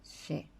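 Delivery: she said with a soft sh sound; She. as a clean sh plus e; Guarani sound. Shown as a demonstration. A teacher modelling the Guarani letter sound. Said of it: "que en guaraní decimos she".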